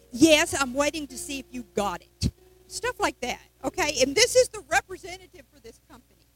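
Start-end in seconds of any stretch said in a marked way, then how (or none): 0.1-2.3 mock rude reply. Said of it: "the lady was so rude".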